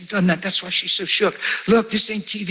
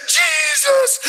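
much higher ecstatic "Jesus"; ecstatic cry. Retold or imitated. Next step, rejoicing.

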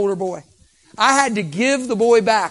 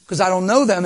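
normal talking voice

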